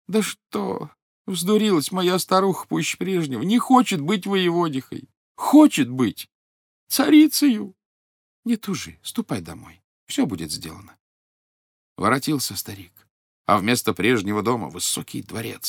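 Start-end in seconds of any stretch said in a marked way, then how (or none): 0.1-7.7 higher, rising complaint; plaintive old man. Acted. Old man complains to fish about his wife, plaintive. 8.4-11.0 lower, softer reassurance; calm fish. Acted. Golden fish reassures the old man, calm and soft.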